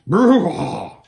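loud snorting horse. Acted. Bree the horse snorting at the strangers, loud. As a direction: snort it like a stallion. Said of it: "he snorted".

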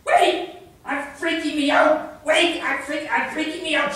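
high, urgent freak-out; panicky shout. Acted. Peace freak protesting mid-argument, high frantic shout. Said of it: "shouted the peace freak".

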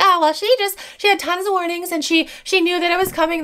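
mock the haters as gossips. Mocking haters.

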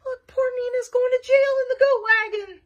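loud, high wail of pity; high-pitched lament. Acted. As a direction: wail it out for poor Nina. Lamenting aside to listeners about Nina.

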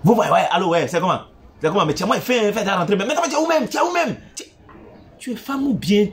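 hammed-up impatience, climbing to high pitch by the end; mock aggressive phone voice. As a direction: mimic someone answering the phone aggressively. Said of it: "vos voix sont agressives à l'oreille".

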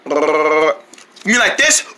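slow burp-voiced words. Trying to gargle, burping instead. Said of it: "You just burped".

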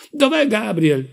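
high, loud and slow desperate cry; frantic shout. A narrator's reading. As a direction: cry out for the missing nephew in panic. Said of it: "gridò".

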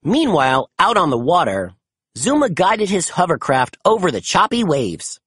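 plain reading voice

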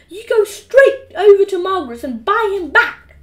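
loud, high angry mum; screamed order. A narrator's reading. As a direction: yell the order at your son. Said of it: "screamed Mum".

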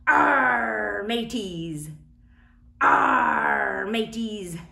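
loud gruff pirate call. Pretend. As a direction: bellow it like a pirate. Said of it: "the little pirate hook".

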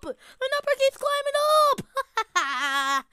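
slow, high, stretched out like a tune; drawn-out sing-song. Acted. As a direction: savor each word, slow and high. Same line stretched out.

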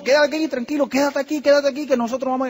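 urgent pleading. Retold or imitated. Pleading brother not to jump.

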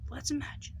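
soft whisper, drawing them in. Acted. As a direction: breathe it like a secret. Hushed invitation to imagine.

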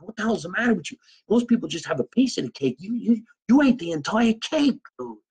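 high-pitched, exasperated telling-off; mock scolding. Comic scolds buddy over the cake, high-pitched mock exasperation. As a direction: scold him in comic disbelief.

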